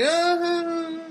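high, drawn-out doubtful uh-huh; a disbelieving high uh-huh; mock unimpressed reply; skeptical uh-huh. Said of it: "And she was like".

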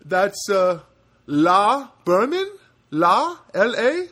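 put-on loud, high, slow Indian accent; drawn-out questioning read-back in an Indian accent. Agent repeating the caller's name, questioning Indian accent. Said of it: "and he repeats back".

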